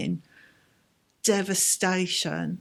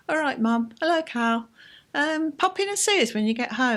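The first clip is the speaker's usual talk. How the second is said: a hint of mum, higher and a bit brighter in intent; imitating mum's casual phone tone, slightly higher; lightly voiced mum; 7 semitones higher than usual.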